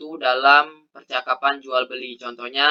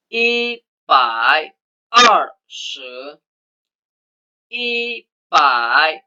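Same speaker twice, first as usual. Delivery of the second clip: I pai 二十 spelled out slowly, syllable by syllable; slow model reading; much slower than usual. Shown as a demonstration. Teacher reading one hundred twenty for the class to follow, slow. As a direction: lay it out slowly so they can copy. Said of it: "teman-teman bisa mengikuti".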